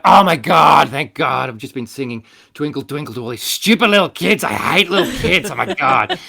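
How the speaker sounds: hammed-up griping performer; mock exasperated rant. Acts out an entertainer ranting about kids.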